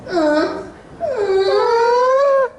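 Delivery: a short anime gasp of worry, climbing high; open mouth worry sound. Shown as a demonstration. Voice actor demonstrating an open mouth worry sound: rising worried gasps. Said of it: "depending on how long the character has its mouth open".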